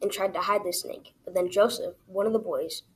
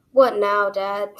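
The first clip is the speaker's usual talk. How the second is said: annoyed; 7 dB louder, much slower than usual. A narrator's reading.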